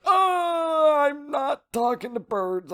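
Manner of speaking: high, panicked yelp of denial; flustered denial. Retold or imitated. Tokyo caught by the girl, panicked high denial.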